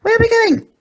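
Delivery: a pug flying, eager to know; high pug voice, excited; eager squeaky pug. A narrator's reading. Porky's voice.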